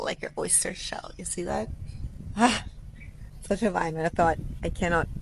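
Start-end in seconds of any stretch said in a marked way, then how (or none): 0.0-1.7 hushed whisper. Real, not acted. Showing viewers the shell by the pool, whispered. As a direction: breathe it out softly.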